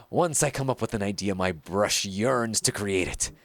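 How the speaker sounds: theatrical, self-important painter with sweeping pitch swings; pompous art master. Acted. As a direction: declaim like a grandiose mentor to a disciple. Reads a pompous painter's game dialogue.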